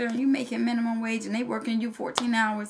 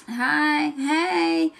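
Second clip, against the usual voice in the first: catcalling guy; 4 semitones higher than usual. Retold or imitated. Mimics a guy hollering at mall.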